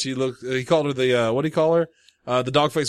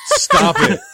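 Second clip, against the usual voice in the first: squealed, far higher and louder; half laughing, half begging them to stop; shrieking stop it through laughter; laughing protest; 7 dB louder than usual. Real, not acted. Cutting off the coon joke.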